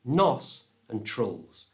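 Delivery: nostrils broken slowly into "nos" and "trulls"; split into syllables. Shown as a demonstration. Teacher splitting the word nostrils for learners, slow separated syllables. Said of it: "split the word into two".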